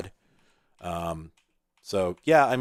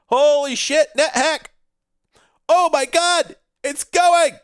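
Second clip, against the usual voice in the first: high, loud squeal of delight; thrilled yelp as the game starts; excited shriek; 8 dB louder, slower than usual. Real, not acted. Streamer shrieking as the game finally launches, much higher and louder.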